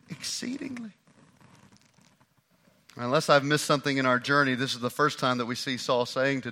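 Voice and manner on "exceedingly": whispered. Acted. Saul's confession trails off.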